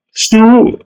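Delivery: sous lifted high and held; stress on sous. Shown as a demonstration. Teacher to pupils, stressing sous. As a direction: lean on the word sous. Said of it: "tu mets un trait".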